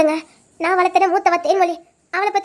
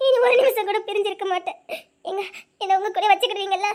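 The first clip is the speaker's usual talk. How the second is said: softer, tearful relief; laughing through tears; 4 dB quieter than usual. Acted. Wife reuniting with husband, laughing through tears. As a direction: cry and laugh at once.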